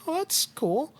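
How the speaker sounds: high-pitched, lightly voiced boss; acted boss. Boss feigning casual interest in retelling.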